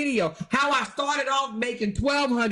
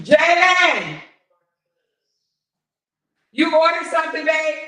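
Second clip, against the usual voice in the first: loud call from across the house; 6 semitones higher, 9 dB louder than usual. Real, not acted. Calling out to Jalen in the house, loud. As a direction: shout up the house to someone.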